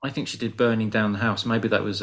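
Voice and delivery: usual speaking voice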